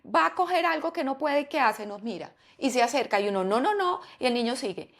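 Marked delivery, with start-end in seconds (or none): 3.4-4.0 put-on warning a toddler. Parent stopping toddler.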